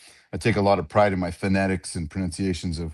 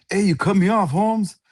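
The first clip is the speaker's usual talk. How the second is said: high, raised-voice retold gripe; mock complaint; 12 semitones higher, 5 dB louder than usual. Ref gripes to Holmes in the airport line, mock complaint. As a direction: call him out in a high imitating voice.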